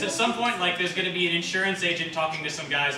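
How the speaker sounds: ordinary delivery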